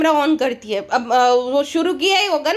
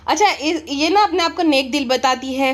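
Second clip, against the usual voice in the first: normal talking voice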